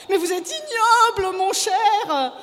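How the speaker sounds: high, loud shriek of IGNOBLE; screaming indignation; outraged insult. A narrator's reading. Wife screams insult, second time.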